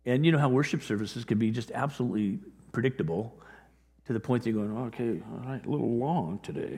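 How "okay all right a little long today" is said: much softer, bored mutter under the breath; bored churchgoer. Retold or imitated. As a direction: sigh it like a restless pew-sitter. Bored worshipper in long service.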